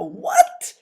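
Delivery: breathless whispered "what?"; whispered disbelief. Real, not acted. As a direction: barely get the word out in shock. Repeated disbelief at the score.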